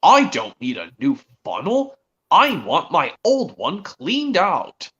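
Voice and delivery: slow, emphatic cartoon engine; put-on character voice with swooping, stretched words; James. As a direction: play an insistent engine, drawing words out. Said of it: "And then James says".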